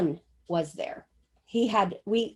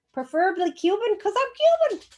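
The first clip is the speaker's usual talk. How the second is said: playful; 10 semitones higher, 4 dB louder than usual. Retold or imitated. Playful aside listing husband wishes.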